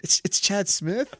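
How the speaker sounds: high, startled double take; mock surprise. Bandmates at the rehearsal room door, mock surprised. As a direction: act stunned to see him.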